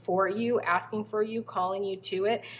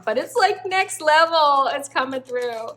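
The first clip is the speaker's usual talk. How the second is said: excited; 6 semitones higher, 7 dB louder than usual. Real, not acted. Excited about her calling.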